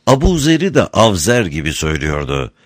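usual speaking voice

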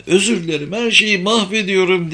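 put-on high-pitched, upset little girl; understated narrated sob; sobbing child. A narrator's reading. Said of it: "diye hıçkırdı".

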